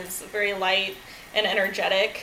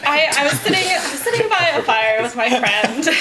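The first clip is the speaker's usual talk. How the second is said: amused laughing; 3 semitones higher, 6 dB louder than usual. Real, not acted. Speaker telling audience about sitting by a fire, laughing. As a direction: start the story while still really laughing.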